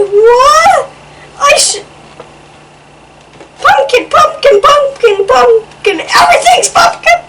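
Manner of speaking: high, loud, laughing outburst; laughing disbelief. Acted. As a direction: cry out amazed that everything is pumpkin. Overwhelmed by all-pumpkin feast.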